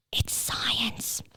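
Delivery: soft ASMR whisper in the ear; whispered reassurance to the anxious listener. Real, not acted. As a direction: breathe it close like ASMR. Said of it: "whispering in your ear and it's like A S M R".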